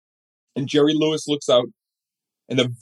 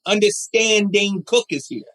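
much higher, slow showman's call; milk it like an old comic working the room; Jerry Lewis impression; 9 semitones higher, slower than usual. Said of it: "the Jerry Lewis voice".

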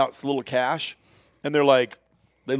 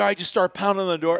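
usual speaking voice

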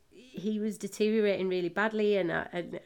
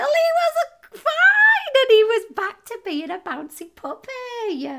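much higher, loud laughing shout of relief; overjoyed; 10 dB louder than usual. Real, not acted. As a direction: burst out with joy that he's fine. Dog survived, joyful news.

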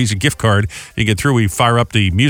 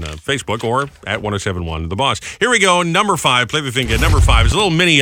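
ordinary delivery

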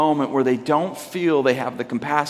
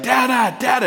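put-on higher-pitched little kid calling out; toddler son; 8 semitones higher than usual. Toddler waking his dad up, imitated in a high voice. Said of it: "my son's two".

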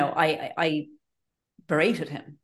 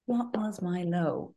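slower and softer, thinking aloud; slow, musing repeat; 6 dB quieter, much slower than usual. Shown as a demonstration. Echoing her friend's question, slow and thoughtful. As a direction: drag it out while searching your memory. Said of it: "what was your low?".

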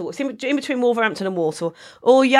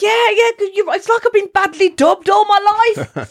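higher, louder, slower mock realisation; hammed-up agreement; 11 semitones higher, 5 dB louder than usual. Agreeing with her friend, hammed-up high and loud. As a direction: play it up like a grand revelation. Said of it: "And I was like".

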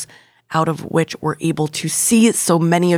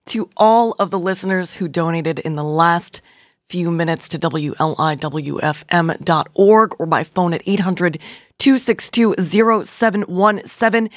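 ordinary talk

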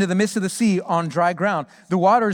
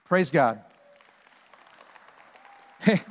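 ordinary talk

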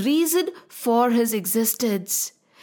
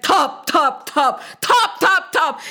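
wave sounds; 2 semitones higher, 3 dB louder than usual. Acted.

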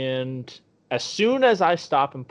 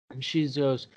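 ordinary talk, nothing marked

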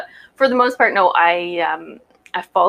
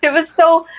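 laughing through the words; giggling while answering; 6 semitones higher, 5 dB louder than usual. Real, not acted. Laughing at being teased.

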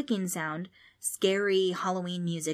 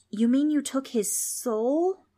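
higher, slow, uneasy question; shaky voice; 7 semitones higher, 5 dB louder, slower than usual. A narrator's reading. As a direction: ask it shakily, pitch climbing. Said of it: "Trix asked with a shaky voice".

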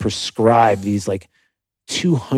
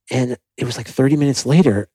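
ordinary delivery

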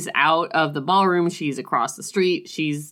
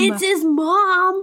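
very high, drawn-out squeal; slow, pitched-up reveal; excited echo; 11 semitones higher, slower than usual. Real, not acted. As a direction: squeal the twist back in delight. Co-host echoes the big reveal.

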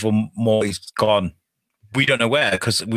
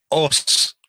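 ordinary talk, nothing marked

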